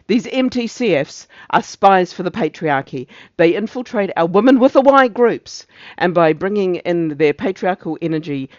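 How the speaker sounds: indignant speech rising into loud peaks; ranting officer. Acted. Officer warning of spies for the patriarchy, declaimed and indignant. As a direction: denounce them, swelling on each slogan.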